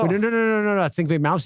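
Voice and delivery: high-pitched rapid string of pleading no's; re-enacted frantic objection; retold protest. Retelling his plea to wife.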